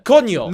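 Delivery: Coño said "KO-nyo", ny sound clearly out; Spanish ñ. Shown as a demonstration. Showing viewers the ñ sound, Coño said loud. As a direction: spit out Coño with a strong Spanish ñ. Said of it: "like, a ñ".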